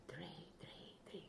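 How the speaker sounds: soft playful whisper. Acted. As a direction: whisper it like a secret.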